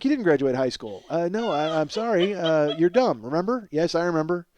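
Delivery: ordinary delivery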